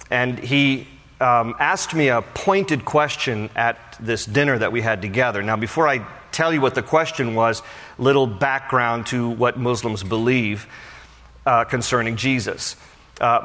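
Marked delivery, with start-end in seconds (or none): none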